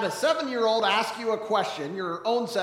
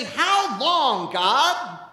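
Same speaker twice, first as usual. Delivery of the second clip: crying out; 3 semitones higher, 6 dB louder, much slower than usual. Retold or imitated. Voicing a cry of lament.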